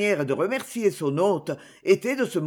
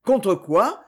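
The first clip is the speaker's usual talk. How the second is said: usual speaking voice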